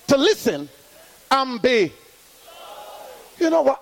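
high, ringing call-and-response preaching; full-force preaching. Acted. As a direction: drive the congregation to answer back. Preaching to congregation.

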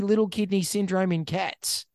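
ordinary talk, nothing marked

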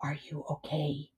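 very soft, hushed voice; whispering robot mother. A narrator's reading. Robot mother checking on her gosling, whispering. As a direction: murmur it close in his ear. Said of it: "she whispered in his ear".

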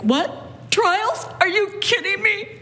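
mock upset disbelief. Preacher voicing the upset Paul never was, mock incredulous. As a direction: scoff at the news in a put-on voice. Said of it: "He's not upset at the prophecies like".

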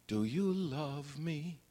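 soft, slow, tender asking; soft plea. Retold or imitated. Tevye asking Golde softly, tender quiet plea. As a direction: ask it gently, almost pleading. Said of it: "So I'm asking you now".

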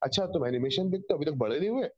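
fast mocking Hindi question; north indian accent. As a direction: tease like a sneering elder. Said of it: "Whenever you say".

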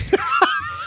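high-pitched burst of laughter. Real, not acted. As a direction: crack up helplessly, very high. Laughing at being called criminal.

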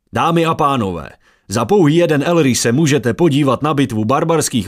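high, loud fairground barker pitching to a crowd; brash huckster calling out bets; carnival barker. Acted. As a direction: drum up the crowd like a showman selling tickets. Errol Shar hawks fight bets.

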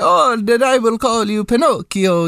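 slow, hammed-up Italian Geppetto accent; mock Italian accent. Mocking Tom Hanks as Geppetto with a hammy Italian accent. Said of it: "really hamming up the Italian".

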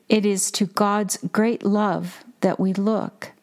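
ordinary talk, nothing marked

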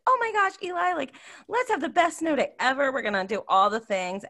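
high, gushing excitement over a snow day; bubbling over with plans; excited. Retold or imitated. To husband about a snow day, high excited gush.

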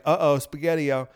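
ordinary talk

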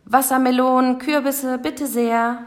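high, sustained hawker's chant; market vendor's call. A narrator's reading. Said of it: "Dort rief es". (hoher, singender Marktruf)

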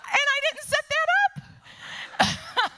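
giddy laughing disclaimer; laughing exclaim. Real, not acted. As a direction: blurt it out laughing. Laughing about reserved seating.

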